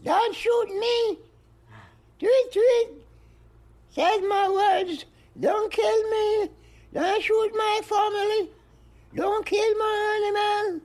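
little bird squeaking out each phrase. Retold or imitated. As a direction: plead in a piping bird voice. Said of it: "says the bird".

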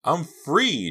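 high, loud exclamation; triumphant announcement. A narrator's reading. Girl announcing her ropes are cut, triumphant. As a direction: announce it with elation. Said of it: "Jade announced".

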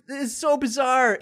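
high, laughing incredulous voice; laughing disbelief. Retold or imitated. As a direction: act out stunned disbelief through laughter.

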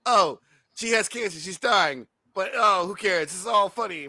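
mock someone who treats everything as a joke; sarcastic, hammed-up amusement; mocking hilarity. Mocks film's nonstop joking.

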